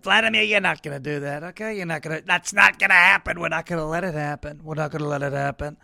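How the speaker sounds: mock hypnotist. Telling protest voters they won't do it, lightly voiced. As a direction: lull them like a movie villain. Addressing RFK voters as Vladimir.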